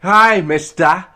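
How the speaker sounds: put-on high, loud, slow little British boy; British orphan boy. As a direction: beg like little orphan Oliver. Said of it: "standard British dialect".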